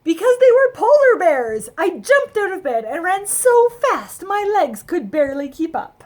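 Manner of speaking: thrilled, breathless rush; overjoyed. A narrator's reading. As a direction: burst out with delight. Excited discovery, running.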